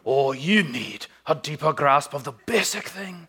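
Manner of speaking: put-on lilting Scottish accent. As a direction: imitate a Scottish pastor's brogue. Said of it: "he said it in a wicked cool Scottish accent".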